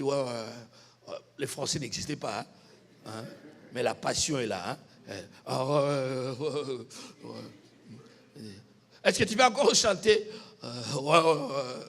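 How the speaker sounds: ordinary delivery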